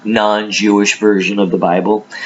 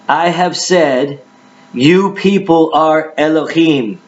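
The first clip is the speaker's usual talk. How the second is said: raised, emphatic Bible reading voice; dramatic scripture reading; 5 semitones higher than usual. Acted. Preacher reads Jesus answering the Judeans, dramatic scripture reading. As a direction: declaim the verses at a higher pitch.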